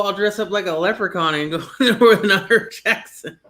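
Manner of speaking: high-pitched, laughing through the joke; amused laughing. Real, not acted. Joking to friend about a leprechaun disguise, high and laughing. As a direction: pitch up and let the laugh break in.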